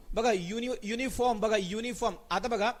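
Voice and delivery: ordinary talk, nothing marked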